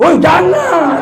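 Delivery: high, loud "woi"; scolding shout. Retold or imitated. Principal yells at pupils heading to the KPU, sharp shout. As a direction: call them back sharply. Principal stops wrong errand.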